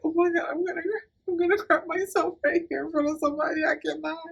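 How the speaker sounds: high, rushed tearful panic. Retold or imitated. Begging her friend before the accident, fast and tearful. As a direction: gabble it out near tears. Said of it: "on the verge of tears".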